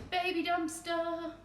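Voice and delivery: high, drawn-out cutesy voice; cutesy cooing. Real, not acted. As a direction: coo it slowly like a pet name. Cooing name of cute tiny dumpster.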